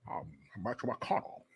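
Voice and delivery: put-on low, soft, mumbling old Southern senator; Mitch McConnell impression.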